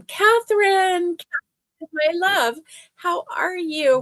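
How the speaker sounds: lifted high, sing-song hello; bright high greeting. Real, not acted. Host greeting a viewer by name, high and cheery.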